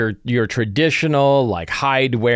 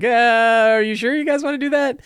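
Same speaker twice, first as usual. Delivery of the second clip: much higher, mock incredulous GM voice; doubtful GM warning; 12 semitones higher than usual. Game master doubting the players' plan, mock incredulous. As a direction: raise an eyebrow at the players' plan, hammed up. GM warns players in retold scene.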